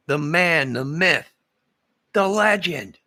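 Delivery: loud, rising showman intro; hype announcer. Pretend. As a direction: build him up like a ring announcer. Hyping up Johnny Dangerous.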